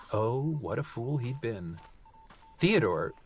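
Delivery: ordinary delivery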